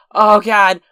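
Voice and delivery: high, loud groan of panic; freaking out. Retold or imitated. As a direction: exclaim it in acted dismay. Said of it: "I'm freaking out".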